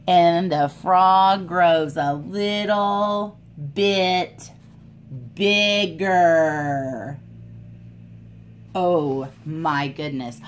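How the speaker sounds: lively storybook voice for little ones; animated read-aloud for kids; reading to children. A narrator's reading. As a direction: read it like a picture book at story time. Reads picture book aloud.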